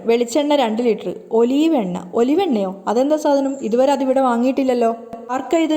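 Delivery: ordinary delivery, nothing marked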